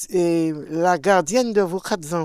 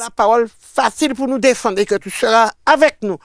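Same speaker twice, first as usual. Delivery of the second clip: fervent prayer; 3 semitones higher, 8 dB louder than usual. Retold or imitated. Quoting scripture to God.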